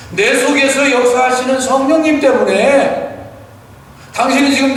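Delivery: preacher re-voicing the husband's bold claim; fervent retelling. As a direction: proclaim it with conviction. Pastor's reply retold with fervor.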